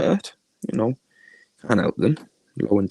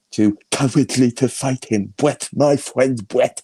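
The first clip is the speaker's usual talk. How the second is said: slow, drawn-out mimicking voice; mocking impersonation; 3 semitones higher, slower than usual. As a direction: draw the words out to mock a rival. Reprising the mocked rival's voice.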